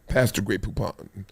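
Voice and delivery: low, quiet, prim mock-posh voice; hushed snooty aside; posh Duncan impression. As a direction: play Duncan as a fancy gentleman asking for mustard. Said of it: "He's just like".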